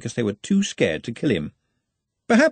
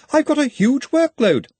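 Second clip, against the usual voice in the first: very high, loud dismay; horrified protest; 5 dB louder than usual. A narrator's reading. Ponder protests the extra job, horrified. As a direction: wail a protest to the boss.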